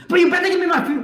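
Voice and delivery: loud, high-pitched insistence; assertive demand. Retold or imitated. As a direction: burst suddenly into a forceful mock demand. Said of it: "she was humble and she was assertive".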